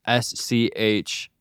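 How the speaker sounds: spelling it letter by letter, slowly; slow careful spelled letters. Real, not acted. Spelling out a hard name.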